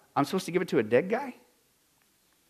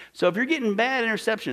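ordinary delivery

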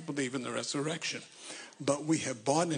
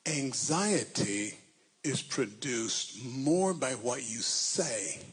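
slow emphatic; much slower than usual. Real, not acted.

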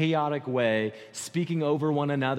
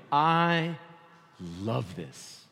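heartfelt sincerity; much slower than usual. Real, not acted. Preacher sharing love of the passage with congregation, heartfelt.

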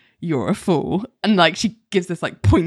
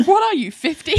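put-on cheeky kid blurting; 11 semitones higher than usual. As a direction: blurt it like a rude little kid. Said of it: "And Sam's like".

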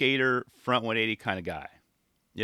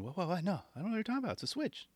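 feigned innocence; 7 semitones higher, 9 dB quieter, much faster than usual. Acted. Skater feigning innocence to judges.

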